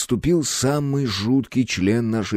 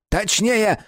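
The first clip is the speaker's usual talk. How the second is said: barked order; 12 semitones higher than usual. A narrator's reading. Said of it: "рявкнул Хлюпа".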